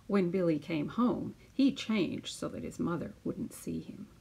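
put-on lively storytelling voice for children; picture book read-aloud. Teacher reading a picture book to her class, lively storytelling. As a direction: read it out like story time on the mat.

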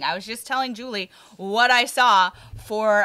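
ordinary talk, nothing marked

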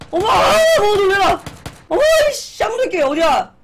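startled yelling; shouting in shock; panicking gamer. Real, not acted. Still startled after scare.

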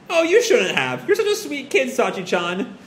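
very high, sweet cooing; gushing thanks. Acted. Mother thanking Sachi for the drink, high gushing voice. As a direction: coo over a kind child, coming down on the name.

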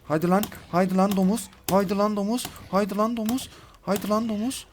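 high-pitched goading call; repeated high, insistent challenge; taunting dare. Real, not acted. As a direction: dare the beast to charge, pitched well up. Daring the hog to attack.

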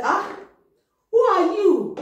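high, loud startled "who are you"; startled question. Acted. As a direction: jump at the unexpected visitor. Old Isaac startled, voiced.

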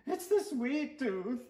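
put-on high, slow kid voice; whiny little boy. Stuart's boy voice.

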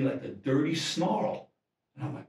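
normal talking voice

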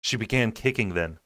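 ordinary delivery

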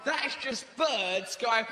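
normal talking voice

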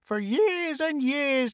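high, slow, drawn-out complaint; long-suffering high-voiced outcry; crying out. A narrator's reading. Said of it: "Trixie cried on".

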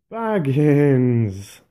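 loud, high joyful call of the name; delighted exclamation. Acted. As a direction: greet him with glad surprise. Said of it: "he exclaimed".

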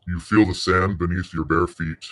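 ordinary talk

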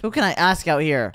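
ordinary delivery

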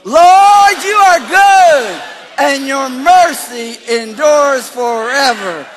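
high, slow, full-force shout; like you mean it. Real, not acted. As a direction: belt out the confession with total conviction. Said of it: "say it like you mean it".

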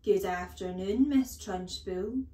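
dutiful singsong class reply, drawn out; slow, rising chorus-style greeting; chirruped greeting. A narrator's reading. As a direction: chirrup it like obedient schoolchildren. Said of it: "they chirruped".

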